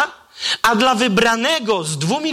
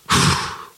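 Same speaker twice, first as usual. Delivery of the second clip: dying exhale. Acted. Acting Jesus's dying breath.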